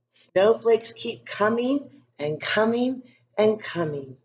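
put-on calm storybook narration for young kids; story reading. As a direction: read it gently to a class of children. Reads picture book to class.

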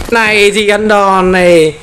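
slow, high mock threat; taunting. Real, not acted. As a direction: drag out the jeer at the boss. Taunting the zombie boss. (giọng chọc tức, chậm và cao)

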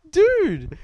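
a sudden high, loud dude; excited exclaim. Real, not acted. Friend reacts loudly to the random photos, excited. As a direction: blurt it out, amazed.

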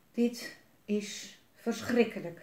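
slow, halting word by word; stammering. A narrator's reading. Defeated alderman leaning on a tree, stammering. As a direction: falter in shocked disbelief. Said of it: "stamelde hij".

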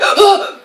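startled sharp gasp. Real, not acted. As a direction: gasp in fright. Jump scare in horror game.

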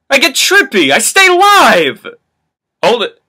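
high, loud hyped rapping; hammy stage actor. Acted. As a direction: rap it like a hype man. Hyped rap lines.

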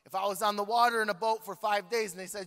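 ordinary talk, nothing marked

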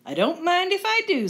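put-on high, lilting little-girl storybook voice; Goldilocks. Goldilocks helping herself to a seat, high storybook voice. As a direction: pitch it up, pleased to help herself. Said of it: "said Goldilocks".

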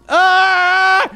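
high effortful shout; loud straining yell; strained yell. Real, not acted. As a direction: force yourself awake with a cry. Trying to wake up.

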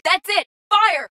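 very high-pitched battle cry; urgent command shout. Acted. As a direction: order the squad to attack. Order to fire.

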